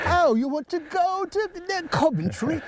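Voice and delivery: high mock British London cabbie accent. Joking about stealing British actors' jobs, mock British cabbie accent. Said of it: "me and John Hamm going over and say".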